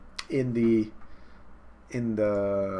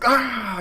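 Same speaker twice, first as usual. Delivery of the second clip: high, loud mock yell; acted cry of impact; 13 semitones higher, 6 dB louder than usual. Punched fighter goes flying, loud high mock cry. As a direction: yell like someone sent flying.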